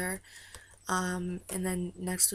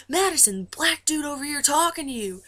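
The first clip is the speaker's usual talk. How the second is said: loud, high call across a room; imitated calling out; 8 semitones higher, 6 dB louder than usual. Tour guy getting the star's attention, imitated loud call. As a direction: holler like an emcee on a mic. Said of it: "he keeps calling Madison's name".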